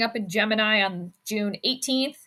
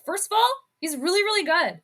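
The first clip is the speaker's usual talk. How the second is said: fast, higher mock of the analyst; imitating the analyst; 7 semitones higher, faster than usual. Parroting the analyst's line for fun. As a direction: rattle off his line in jest. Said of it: "I'm gonna start saying that".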